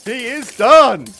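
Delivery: triumphant cry. Real, not acted. Boss defeated.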